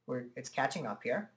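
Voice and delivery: ordinary talk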